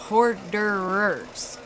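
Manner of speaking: "Hoarderers" very slow, syllable by syllable; over-enunciated. Shown as a demonstration. Streamer spelling it out for viewers, over-enunciated. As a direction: break "Hoarderers" into long separate syllables. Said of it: "Do I gotta spell it out?".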